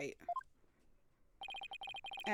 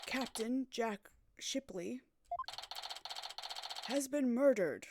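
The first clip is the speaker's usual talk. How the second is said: news anchor. Acted. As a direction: announce it like breaking news. TV news report read.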